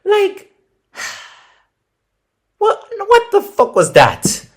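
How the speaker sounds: high, loud, outraged disbelief; can't believe what he's hearing; stunned disbelief. Real, not acted. True-crime host outraged at the lenient sentence, in loud stunned disbelief.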